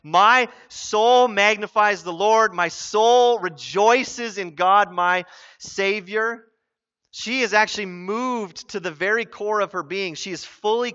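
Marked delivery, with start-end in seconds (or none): 0.0-6.5 slow, louder weight on "soul" and "rejoices"; drawn-out, higher emphasis on "My soul"; emphatic. Acted. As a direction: proclaim "soul" firmly, stretching each phrase. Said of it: "an emphatic point".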